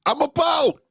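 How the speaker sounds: high, shouted getaway cry; hammed-up getaway shout; excited Lot. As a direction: Lot bolting off, whooping. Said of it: "he just said".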